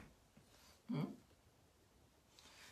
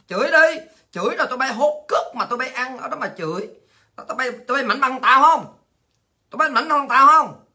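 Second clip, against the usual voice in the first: loud, high, furious jeering; angry taunting shout; 4 semitones higher, 5 dB louder than usual. Real, not acted. Shouting down the Party's propagandists, furious and loud. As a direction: yell the challenge at them with scorn.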